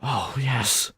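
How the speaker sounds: lightly voiced suggestive repairman; mock seductive maintenance man. Maintenance man unbuckling his pants, mock seductive. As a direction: ham up a come-on from the repairman.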